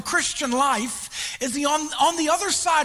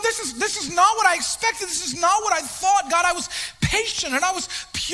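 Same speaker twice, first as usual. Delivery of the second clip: higher, raised indignant protest; frustrated lament; 5 semitones higher than usual. Retold or imitated. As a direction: insist you did everything right. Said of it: "You're going".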